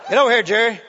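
loud call with a laugh in it; calling over. Retold or imitated. Preacher calling the helper forward, loud. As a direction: summon him across the room. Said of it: "he said".

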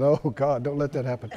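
low, hushed mock dread; hand-wringing worry. Worried people reacting to a king, hushed fretting. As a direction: mutter a worried plea under your breath. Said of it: "wringing their hands going".

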